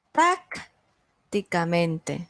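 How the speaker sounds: very slow "Prácticamente", long pause after Prác; syllable break after PRÁC. Shown as a demonstration. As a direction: isolate PRÁC, then finish "ticamente". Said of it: "Un ejemplo de una palabra sobre estrújula".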